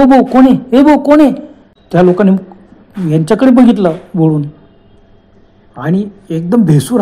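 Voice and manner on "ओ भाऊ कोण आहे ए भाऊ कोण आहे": loud, higher call up the hill; shouting up. Retold or imitated. Hikers shouting from below to men on a hill, loud shout. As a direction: yell up to the men on the slope. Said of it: "यांनी खालून आरडलं".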